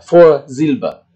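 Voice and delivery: Vorsilbe drawn out slowly, falling at the end; German model word. Shown as a demonstration. Teacher to class: slow model pronunciation. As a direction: say Vorsilbe very slowly for students to copy. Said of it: "adalah suku kata yang berada di depan".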